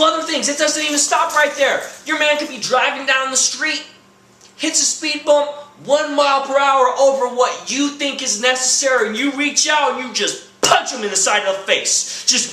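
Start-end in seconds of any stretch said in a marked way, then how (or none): none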